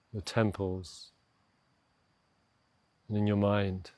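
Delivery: unhurried meditation voice with long pauses; guided meditation. Acted. Coach slowing a stressed listener's morning to a pause, calm guided meditation. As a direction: guide them gently down into stillness, leaving space to breathe.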